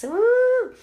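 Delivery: high, loud whoop; cheer. Real, not acted. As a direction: cheer for your team. Cheering her Saints.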